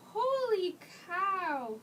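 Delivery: ordinary talk, nothing marked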